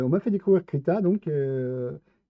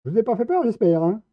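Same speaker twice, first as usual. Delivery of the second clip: higher, louder mock-polite apology; 8 semitones higher, 8 dB louder than usual. Gamer mock-apologizing to the fried monster ladies, higher and louder. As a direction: fake concern for the monsters he just fried.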